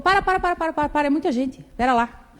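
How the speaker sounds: high, quick stop-stop-stop; a grandmother halting a long confession in a high voice; grandma cutting in. Retold or imitated. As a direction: cut her off in a high, hurried grandma voice. Grandmother interrupting her.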